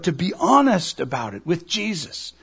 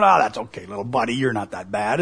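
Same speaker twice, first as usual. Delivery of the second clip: dismissive reassurance; 8 semitones lower than usual. Retold or imitated. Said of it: "Jesus isn't going".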